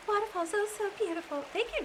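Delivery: a hint of an elderly lady; Magdalena voice. Shown as a demonstration. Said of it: "Magdalena could talk like this".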